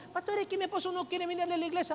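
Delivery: quick, high mock wife's excuse; complaining wife. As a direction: imitate a wife pleading her excuse to the pastor. Voicing a complaining church wife.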